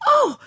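sudden loud, high yelp; high pained cry. A narrator's reading. Anne bitten on the finger by the mole, pained cry. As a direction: cry out sharply on a high note. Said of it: "she cried".

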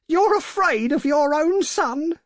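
high, loud, incredulous challenge; struggling cry. A narrator's reading. Wife struggling against her frightened husband, outraged cry. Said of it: "she cried, struggling".

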